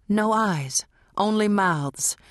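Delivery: ordinary delivery, nothing marked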